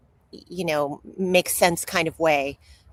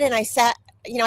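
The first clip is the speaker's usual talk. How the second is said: normal talking voice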